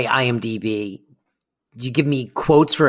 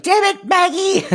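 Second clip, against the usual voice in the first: much higher, nasal Fran voice; Fran waking up. Retold or imitated. S1 imitating Fran waking from a nightmare, high-pitched squawk. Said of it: "she wakes up and she's like".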